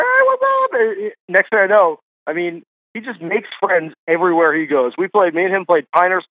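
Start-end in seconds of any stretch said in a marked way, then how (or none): 0.0-0.7 very high-pitched imitated hello; effusive mock greeting. Friend greeting some guy on the golf course, high imitated delight. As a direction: mimic an instant best pal's greeting.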